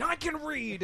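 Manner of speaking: high-pitched exasperated mock yell; retold yell. Teacher worn down by kids' taunts, high mock yell. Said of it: "you're just yelling".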